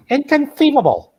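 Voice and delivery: very high, exclaimed catchphrase; Wallace Shawn. Acted. Fan quoting The Princess Bride, high exclaimed impression. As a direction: squeal it as a hammed-up movie quote. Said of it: "Wallace Shawn".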